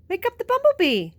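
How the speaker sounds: high, loud, fast squirrel. A narrator's reading. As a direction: chirp it out quick like a squirrel. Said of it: "said the squirrel".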